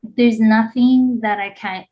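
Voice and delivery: ordinary talk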